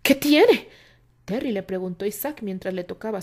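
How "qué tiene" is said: loud anxious question. A narrator's reading. Terry asks anxiously.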